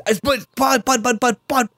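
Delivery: high, hammed-up yelled buts; mock screaming. Host goofing in a mock scream. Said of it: "Why are you screaming about butts?".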